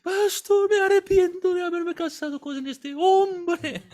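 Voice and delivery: high, loud tearful wailing; a wife sobbing out her regret; crying plea. Retold or imitated. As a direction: beg the pastor through tears. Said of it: "llorando, llorando".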